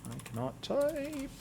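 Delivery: slow high-pitched self-chiding; high self-mocking complaint. Real, not acted. Game master fumbling at the keyboard, high self-mocking complaint.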